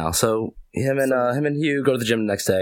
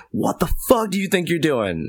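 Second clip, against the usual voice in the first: blowing up; 6 semitones higher than usual. Retold or imitated. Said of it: "he just blows up".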